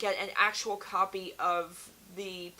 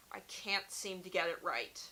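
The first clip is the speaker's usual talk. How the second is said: ordinary delivery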